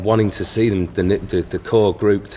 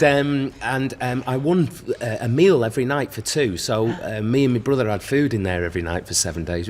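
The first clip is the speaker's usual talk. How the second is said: usual speaking voice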